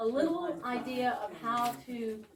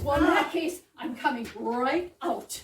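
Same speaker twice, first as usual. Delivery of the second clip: loud retort; 2 semitones higher, 7 dB louder than usual. Acted.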